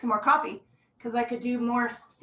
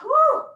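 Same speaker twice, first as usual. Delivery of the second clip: excited woo; 13 semitones higher, 7 dB louder than usual. Real, not acted. Cheer during big stretch.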